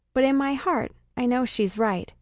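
usual speaking voice

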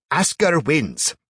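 slow, loud, high proclamation; announcing. A narrator's reading. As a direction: announce the winner to the crowd. Arbiter declares the victor.

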